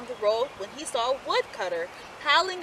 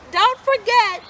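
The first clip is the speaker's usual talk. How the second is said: higher, louder mother calling after them; 9 semitones higher, 6 dB louder than usual. A narrator's reading. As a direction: call a warning after the pigs. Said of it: "called their mother as she waved goodbye".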